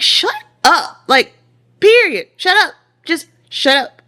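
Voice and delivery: high, loud, fed-up retort; exasperated outburst. Retold or imitated. Wife replaying her reaction to husband's morning chatter, fed up. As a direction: burst out in high-pitched annoyance.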